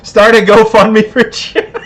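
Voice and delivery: talking through laughter; laughing. Real, not acted. Host joking with the chat about drinkers, laughing as he talks. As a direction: crack up while saying it.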